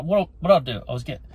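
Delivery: ordinary talk, nothing marked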